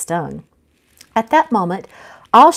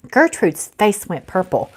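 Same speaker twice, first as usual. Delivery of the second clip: plain reading voice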